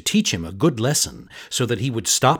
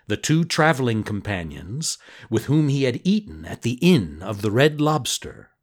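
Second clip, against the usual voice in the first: usual speaking voice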